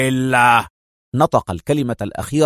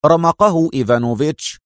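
ordinary delivery

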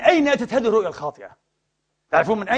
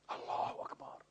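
a whispered exclamation of awe; awed whisper; 21 dB quieter than usual. Real, not acted. Preacher whispering to himself after the hadith, awed whisper. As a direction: breathe it out in a hushed whisper.

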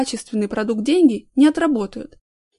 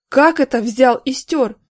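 louder, slow stern question; thundering; 4 semitones higher, 6 dB louder, slower than usual. A narrator's reading. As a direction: thunder at the culprit. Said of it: "загремел Горин".

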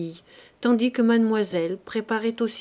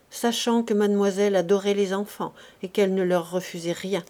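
plain reading voice

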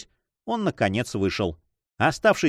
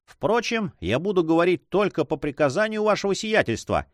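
ordinary delivery

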